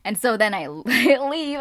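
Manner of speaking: normal talking voice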